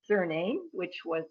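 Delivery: normal talking voice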